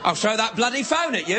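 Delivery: hammed-up Australian accent, lifting at the end; mock Australian. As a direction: throw out an Australian threat as a question. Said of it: "I'm Australian".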